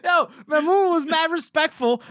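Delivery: high, laughing half-cry; laughing protest. Real, not acted. Host laughing at the insult scene, high and breaking. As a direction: protest through giggles.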